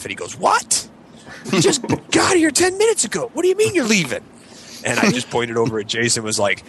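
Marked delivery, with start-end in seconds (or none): 0.4-4.2 shrill, much higher disbelieving cry; incredulous host. Retold or imitated. Host hearing his guests leave already, high incredulous cry. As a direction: throw the words up high in shocked disbelief.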